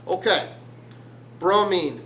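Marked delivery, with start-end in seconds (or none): none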